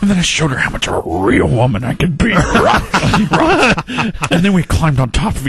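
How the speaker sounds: a hint of a sleazy lounge guy; low sleazy voice. Norm continues low sleazy story.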